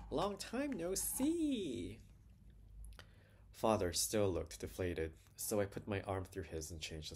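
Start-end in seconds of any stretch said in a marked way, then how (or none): 0.1-2.0 very high, drawn-out parrot call; high, stretched cheerful squawk of a greeting; cheerful old-man parrot. A narrator's reading. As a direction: sing out slowly like a cheery parrot. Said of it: "shout in its cheerful old man's voice".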